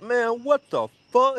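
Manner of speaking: high-pitched mock outrage; hammed-up high complaint; talking shit. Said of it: "he was talking shit".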